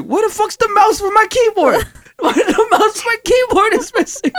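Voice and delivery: 2 high, laughing disbelief; 3 squeal it out, half laughing at the absurdity; 1 incredulous outrage. Retold or imitated. 4 boyfriend finds even his mouse gone, high laughing disbelief. Retold discovery of missing mouse.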